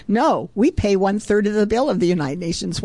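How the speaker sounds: usual speaking voice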